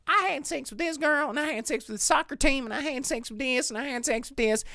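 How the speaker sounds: high sugary Southern gush, breathless list; mock Southern boast. Mocking the movie character's Southern bragging, high and giddy. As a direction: gush it out like a hammed-up Southern party girl. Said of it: "she just runs up and she's like".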